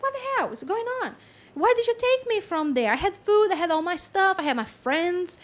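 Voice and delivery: high-pitched, rapid mock outrage; indignant lamb. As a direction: complain like a lamb snatched from home. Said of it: "and the lamb".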